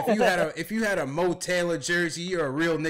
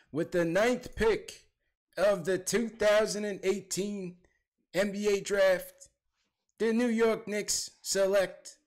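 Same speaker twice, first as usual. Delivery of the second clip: slow, higher-pitched draft announcer voice; Adam Silver impression; 6 semitones higher, slower than usual. Podcast host playing commissioner at the draft, slow ceremonial announcement. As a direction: announce it formally, stretched out with pauses. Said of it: "let me get my Adam Silver voice on".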